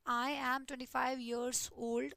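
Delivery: very slow, each word separate; slow model sentence for the board; slow dictation. Shown as a demonstration. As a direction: lay out the first option slowly. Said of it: "दो तरीके होते हैं आपके पास बोलने के".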